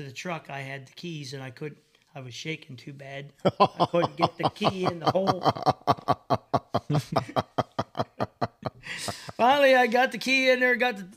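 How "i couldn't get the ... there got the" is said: laughing. Retold or imitated. Recalling shaking hands.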